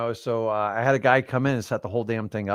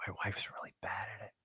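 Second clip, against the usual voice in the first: hushed confession, no voice; a guilty aside whispered behind her back; 4 semitones lower, 20 dB quieter than usual. Real, not acted. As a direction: whisper it so she can't hear. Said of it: "I didn't say that out loud".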